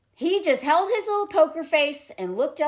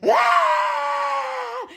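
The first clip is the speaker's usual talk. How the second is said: put-on a sudden high shout like a toddler mid-tantrum; mock tantrum yell, much higher and louder; mimicked child scream; 11 semitones higher, 3 dB louder than usual. Imitating the screaming boy at the cafe, high mock shout.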